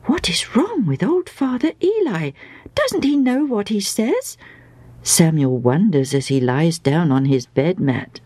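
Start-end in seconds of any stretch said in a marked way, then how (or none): 0.0-4.4 put-on high, swooping puzzled boy; bewildered, pitch leaping up and down. A narrator's reading. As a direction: wonder in a child's high voice. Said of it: "very puzzled".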